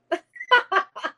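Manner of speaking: loud, high-pitched laugh; real laugh. Real, not acted. Host laughing after losing Rob mid-story, real high laugh.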